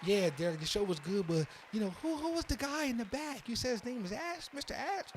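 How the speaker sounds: high, light mock lady voice, rising to the name; playful impression of women asking who Ash is; flirty ladies asking. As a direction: tease him as the curious ladies. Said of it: "they're gonna be like".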